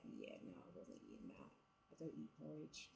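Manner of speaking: muttering to herself about the porridge; under-the-breath aside. Retold or imitated.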